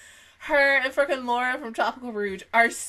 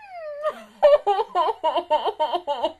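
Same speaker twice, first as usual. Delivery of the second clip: teary laugh breaking up; overwhelmed giggle sob; laugh-crying; 6 semitones higher, 3 dB louder than usual. Real, not acted. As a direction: laugh until it turns to tears. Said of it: "I'm crying".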